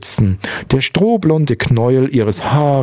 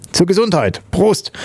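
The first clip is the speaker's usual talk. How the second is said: much higher-pitched, festive call; hearty toast; 9 semitones higher than usual. A narrator's reading. German father drinking to the newborns, hearty toast. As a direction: toast exuberantly, pitched right up.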